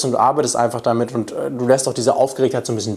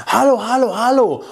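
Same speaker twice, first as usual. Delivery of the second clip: calling out; 9 semitones higher than usual. Shown as a demonstration. Coach acts a student approaching a woman, calling out. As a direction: call after her to get her attention.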